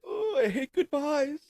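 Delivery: high, drawn-out mock sentimentality; hammed-up farewell, slow and high; mock tearful goodbye. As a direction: draw it out in a high put-on voice. Acted farewell hug.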